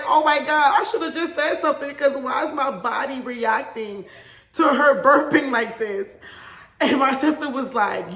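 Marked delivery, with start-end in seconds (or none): 0.0-6.1 high, loud, laughing alarm; laughing panic. Retold or imitated. Retelling her numb side to viewers, laughing panic.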